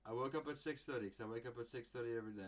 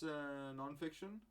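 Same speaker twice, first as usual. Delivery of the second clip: ordinary talk, nothing marked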